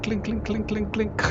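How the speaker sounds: imitating a clink. Shown as a demonstration. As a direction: tap out little clinks. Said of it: "you hear a little clink".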